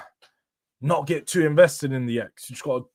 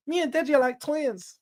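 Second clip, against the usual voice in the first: much higher, hammed-up zesty mock voice. Streamer mocking a chatter's twins line in a zesty voice. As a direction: parrot the chat line in an over-the-top zesty tone. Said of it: "Some zesty ass shit".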